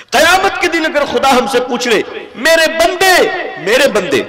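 loud, high sermon voice; impassioned preacher; fiery preaching. Acted. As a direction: rebuke the congregation at full force. Preaching, voicing God.

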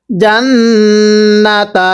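জান্নাতা with a long hummed double ন; ghunnah. Shown as a demonstration. Teacher shows noon with tashdid, ghunnah. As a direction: hold the ন্ন of জান্নাতা in the nose, then release. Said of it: "নুনে তাজদিদ গুন্নাহ করে ওয়াজিব".

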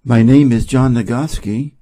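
ordinary delivery, nothing marked

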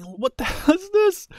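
high-pitched baffled outburst with a laugh. Real, not acted. Gamer reacting to a bizarre helicopter, baffled. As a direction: laugh in disbelief, voice jumping up.

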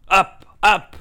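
booming royal wake-up call; roaring king. A narrator's reading. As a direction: bellow it like a king rousing his men. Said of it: "the king roared".

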